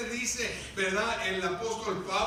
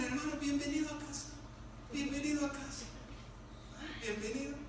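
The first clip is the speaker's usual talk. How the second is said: soft, higher-pitched welcome; carceleros acogedores; 4 semitones higher, 9 dB quieter, faster than usual. Acted. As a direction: greet him gently like family returning. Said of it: "Le decían". (bienvenida suave, voz aguda)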